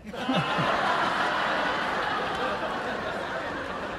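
breathy wheeze of a laugh; airy laugh. Real, not acted. Preacher laughing at his own joke, airy laugh. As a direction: let the laugh escape silently.

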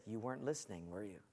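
low, very soft reproach; quietly convict the listener; gentle reproof. Retold or imitated. The Lord correcting a young dad, low hushed reproof.

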